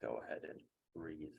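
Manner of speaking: soft, slow counting with long pauses; calm, hushed meditation guide; guided breathing. Acted. Meditation instructor counting a class through box breathing, soft and slow.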